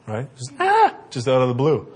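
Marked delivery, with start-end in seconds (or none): 0.6-0.9 put-on sudden high shrieking baby yell; imitated baby scream. Imitating a toddler screaming out of the blue, high and loud. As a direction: let out a loud baby screech. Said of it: "They start screaming".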